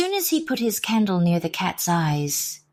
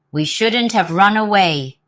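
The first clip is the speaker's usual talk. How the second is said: growled; 4 semitones higher, 6 dB louder than usual. Acted. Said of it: "growled the leader".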